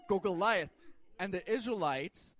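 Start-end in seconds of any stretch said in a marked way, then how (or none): 0.1-0.7 big, loud crowd cheer. Acted. Philistines cheer their giant on, loud rooting. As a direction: cheer on your champion at full volume.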